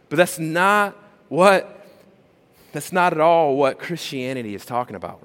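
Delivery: ordinary delivery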